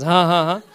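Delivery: loud, deliberate ha-ha-ha; big declaring belly laugh; demonstrative laugh. Shown as a demonstration. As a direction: laugh out boldly on each ha. Laughs at moving mountains.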